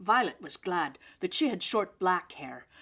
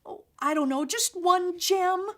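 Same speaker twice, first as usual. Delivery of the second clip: pleading; 11 semitones higher, 5 dB louder than usual. A narrator's reading. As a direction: drag out the plea for one gem. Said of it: "pleaded Stephanie".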